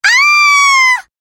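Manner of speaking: piercing high scream of alarm; a sudden shrill shriek from the factory; loud scream. A narrator's reading. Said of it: "a loud scream came".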